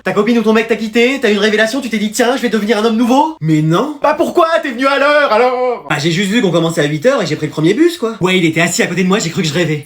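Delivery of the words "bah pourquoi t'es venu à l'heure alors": higher, pressing demand; exasperated questioning. Acted. Teacher grills a pupil who came on time, exasperated. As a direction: demand an explanation, voice raised in pitch.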